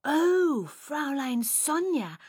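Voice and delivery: high, drawn-out gush; delighted. A narrator's reading. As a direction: exclaim as if struck by a wonderful idea. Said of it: "very pleased with myself".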